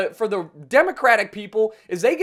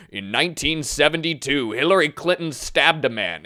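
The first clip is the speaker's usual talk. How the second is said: lower, dramatic political ad narrator; attack ad voice; 6 semitones lower than usual. Retold or imitated. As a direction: intone it like a smear-campaign announcer. Mock attack ad.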